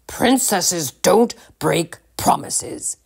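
slow, firm royal rebuke; stern king. A narrator's reading. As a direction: lay down the rule sternly. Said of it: "interrupted the king sternly".

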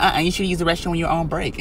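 imitating manager. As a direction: mimic the bossy manager. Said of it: "she be like".

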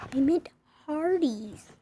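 ordinary delivery

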